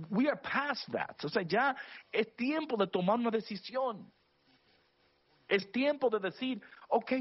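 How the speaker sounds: high-pitched, urgent preacher delivery; impassioned preaching. Real, not acted. Preacher urging the listeners to believe God, impassioned.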